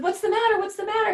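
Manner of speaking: high, loud panicked question; frantic mother asking what's wrong; worried shriek; alarmed. Retold or imitated. Mom alarmed by sobbing son.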